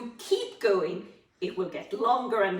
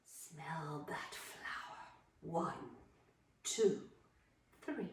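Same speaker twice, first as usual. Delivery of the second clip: slow, soft whisper while inhaling; 4 semitones lower, 13 dB quieter, slower than usual. Shown as a demonstration. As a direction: breathe the words out as a slow whisper. Guiding a calm breathing exercise.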